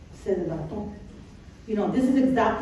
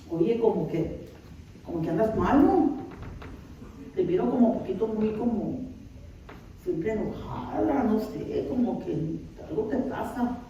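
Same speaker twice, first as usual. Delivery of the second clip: softer, hesitant, careful probing; tentative confronting; 8 dB quieter than usual. Acted. Someone carefully telling you that you seem upset, tentative. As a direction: ease into an awkward remark, trailing softly.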